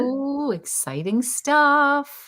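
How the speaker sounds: high, drawn-out excited coo; playful hype. Real, not acted. Host hears it is a medium nib, high slow playful excitement. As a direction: stretch it out high, hyping a small reveal. Hyping the nib size reveal.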